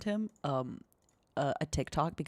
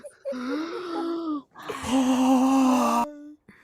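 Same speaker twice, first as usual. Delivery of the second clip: sharp shocked gasp; loud gasp; 9 semitones higher, 8 dB louder than usual. Real, not acted. Startled at the end.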